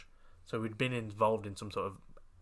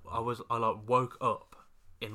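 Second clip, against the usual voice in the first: normal talking voice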